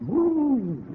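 a high, breathy whoop of feeling; high woo. Real, not acted. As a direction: cry out in joy. Preacher's exclamation of feeling.

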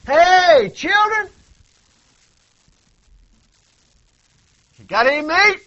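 high, loud call over the water; hollering out. Acted. Jesus calling the disciples from the shore, hollering. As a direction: shout it out to the boat. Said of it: "he hollers out".

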